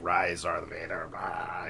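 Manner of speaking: lightly voiced low, slow Emperor; a hint of the Emperor's slow growl. Retelling the film, a hint of the Emperor's voice. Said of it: "the Emperor says".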